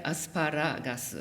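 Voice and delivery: "asparagus" slowly, Japanese-style, as a-su-pa-RA-ga-su; Japanese accentuation. Shown as a demonstration. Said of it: "Notice that it's different from the".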